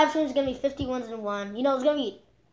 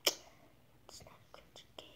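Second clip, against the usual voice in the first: slow unvoiced whisper; 21 dB quieter, slower than usual. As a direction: whisper it like a secret. Whispered aside to viewers.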